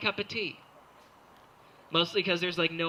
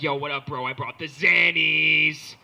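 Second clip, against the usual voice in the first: slow, louder bro swagger at the end; party bro; 8 dB louder, slower than usual. Retold or imitated. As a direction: stroll in like the guy with pills. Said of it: "He's like".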